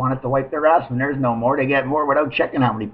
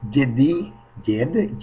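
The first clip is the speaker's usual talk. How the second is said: normal talking voice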